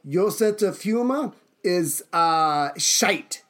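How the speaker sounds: slow, deliberate mock-German dressing-down; comic dictator with German accent; acted Hitler. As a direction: draw out the rebuke in character. Plays Hitler scolding the officer.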